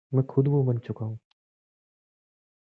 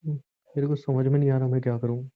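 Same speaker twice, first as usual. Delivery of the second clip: usual speaking voice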